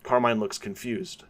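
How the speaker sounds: ordinary delivery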